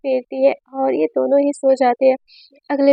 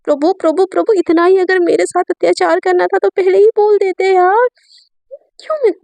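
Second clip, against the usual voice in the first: higher pleading cry to God, rising to a wail; mock despairing lament; 5 semitones higher than usual. Wife cries out to God about her fate, a hammed-up wail. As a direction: whine to the heavens about your fate.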